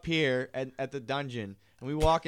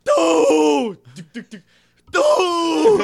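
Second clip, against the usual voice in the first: yelling Patrick; 12 semitones higher, 11 dB louder, much slower than usual. Retold or imitated. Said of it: "He goes".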